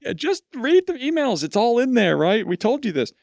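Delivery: exasperated manager who won't take the blame, mock whine. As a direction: ham up a dismissive boss brushing it off. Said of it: "my immediate reaction is".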